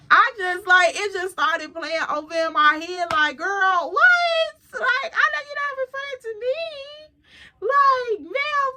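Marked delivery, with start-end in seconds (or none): 3.4-8.7 sassy retort. Pretend. Telling off the woman in her head, high and sassy. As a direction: squeal it high like you can't believe her nerve.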